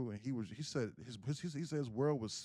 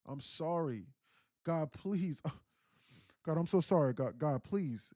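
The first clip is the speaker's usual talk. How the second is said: pleading with God, acted out; impassioned bargaining prayer in a storyteller's voice; retold desperate prayer; 3 semitones higher, 4 dB louder than usual. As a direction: beg forgiveness urgently, swelling and falling. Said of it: "he said he got on his knees".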